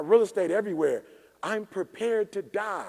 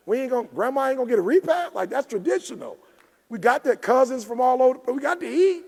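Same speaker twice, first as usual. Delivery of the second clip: louder and higher, pleading with the family; retold family argument; 6 semitones higher, 7 dB louder than usual. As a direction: push it up like you're insisting on tradition. Retold arguing with family about repast.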